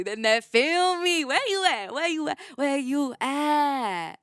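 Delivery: high, loud rallying call, drawn out; calling out excitedly. Real, not acted. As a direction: shout out to your people, looking for them. Calling out to her people.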